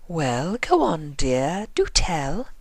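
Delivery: amused coaxing. Acted. Rarity coaxing Twilight to confess, amused. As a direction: tease the story out of her. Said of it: "an amused twinkle in her eyes".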